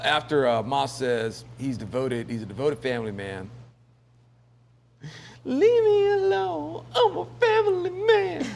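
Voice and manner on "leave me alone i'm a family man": mock crying plea. Mocking the devoted family man.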